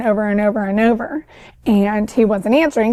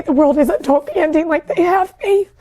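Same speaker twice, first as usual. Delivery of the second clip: usual speaking voice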